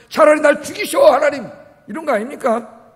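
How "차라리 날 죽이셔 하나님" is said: angry outburst at God, loud and high; 화내는 요나. Retold or imitated. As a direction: hurl an exasperated plea upward, raised pitch. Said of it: "화를 내면서". (화내며 하나님께 크게 대드는 목소리)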